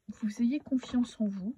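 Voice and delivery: usual speaking voice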